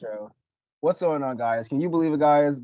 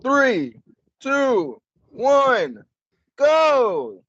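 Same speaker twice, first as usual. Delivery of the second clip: higher, louder hype countdown; excited countdown; 11 semitones higher, 4 dB louder, much slower than usual. Acted. Counting his co-host into the episode, excited and higher. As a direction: count them in with rising hype.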